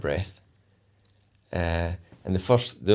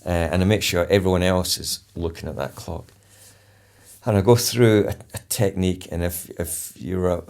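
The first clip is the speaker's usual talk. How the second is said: ordinary talk, nothing marked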